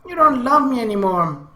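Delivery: tearful whine. Acted. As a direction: sulk like a child near tears. Akemi about to cry.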